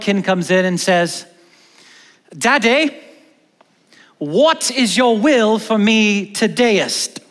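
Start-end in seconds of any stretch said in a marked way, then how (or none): 2.4-7.2 a kid's high, slow pleading in hammed-up Old English accent; child asking dad in a put-on Old English accent; mock Old English accent. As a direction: stretch it out like a kid playing at King James Old English accent. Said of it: "Why are you speaking in that horrible accent?".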